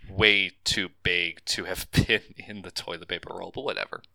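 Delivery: normal talking voice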